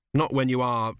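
ordinary delivery, nothing marked

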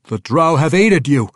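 arguing captive. A narrator's reading. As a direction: argue your case forcefully. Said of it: "the dark elf argued".

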